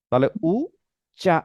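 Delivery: usual speaking voice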